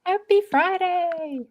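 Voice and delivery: high, slow sing-song echo. Acted. Echoed greeting, high.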